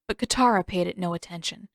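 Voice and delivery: ordinary delivery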